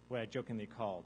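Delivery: usual speaking voice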